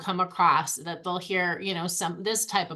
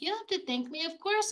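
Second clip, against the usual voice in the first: put-on high, soft reassurance to a young girl; a store clerk brushing off her thanks, high and gentle; 9 semitones higher, 5 dB quieter, faster than usual. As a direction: lift the voice up and soothe her, softly.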